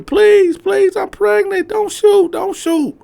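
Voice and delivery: extremely high, loud, desperate; screaming plea. Retold or imitated. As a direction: scream for your life. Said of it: "baby mama screaming".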